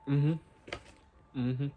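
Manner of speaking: humming while chewing; closed-mouth mumble; mouth full. Real, not acted. Seen 0: Took a bite at -1; lips closed, chewing while humming.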